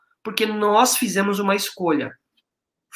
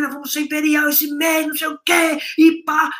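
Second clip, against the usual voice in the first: higher, eager wife voice imitated; excited wife; 5 semitones higher than usual. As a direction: mimic her wide-eyed rush of excitement. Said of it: "subiu com o olho desse tamanho".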